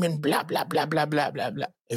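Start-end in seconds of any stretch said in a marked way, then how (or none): none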